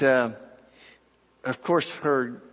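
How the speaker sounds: usual speaking voice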